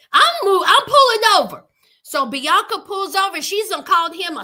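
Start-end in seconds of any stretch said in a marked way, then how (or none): 0.1-1.7 louder, higher firm line; firm announcement. Retold or imitated. Bianca telling Daniel she is pulling over. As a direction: state it with resolve. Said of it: "Bianca says".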